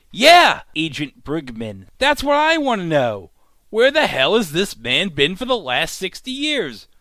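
high, loud eager agreement; excited shout. Real, not acted. Vlogger cheering on Agent Brigman's question, loud.

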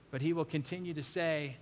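ordinary talk

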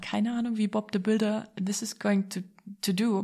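usual speaking voice

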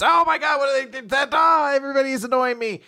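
put-on high, loud teenage meltdown; hammed-up exasperated rant; annoyed outburst. Retold girl erupts at parents.

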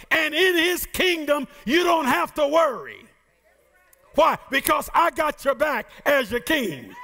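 rising preacher's cadence, calling out the promise; preaching. Acted. Preacher to congregation about the kingdom, fervent preaching. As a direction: preach it with full conviction to the church.

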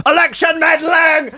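high mock outraged cry; hammed-up shriek of election meddling; mock shout. Mocking Trump over the news, high outraged shout.

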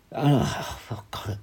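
a despairing whispered aside; whispered under the breath in disbelief; exasperated whisper. Real, not acted. Exasperated aside mid-story.

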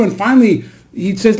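usual speaking voice

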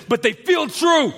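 high, drawn-out angry shout; angry retort. Retold or imitated. Brother retorting to his sister, angry shout. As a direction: shout it back in protest. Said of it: "Anger popped up in me".

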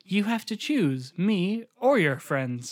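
slow, stilted bad-acting delivery; mock wooden dialogue, drawn out word by word; flat film girlfriend. Co-host playing the girlfriend to the boyfriend, stilted and flat. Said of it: "that's exactly how they talk".

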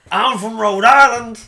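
loud, high, slow mock Rhode Island accent; over-the-top drawn-out Rhode Island accent. Shown as a demonstration. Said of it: "I'll do the accent of that state".

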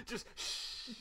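whispered shh with a laugh; playful shush. Retold or imitated. Friend jokingly shushing her during the show, whispered. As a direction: hush them like a friend. Joking advice to just watch.

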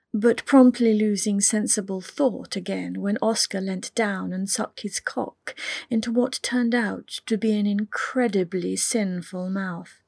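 ordinary delivery

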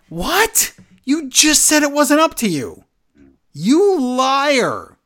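high, loud incredulous cry; indignant what, rising high; outraged disbelief. Real, not acted. Outraged reaction to Rollins.

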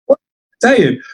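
high-pitched incredulous question; baffled, pitch leaping up; retold bafflement. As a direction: squeak up in mock disbelief. Teammates' retold baffled reply.

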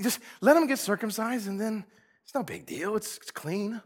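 usual speaking voice

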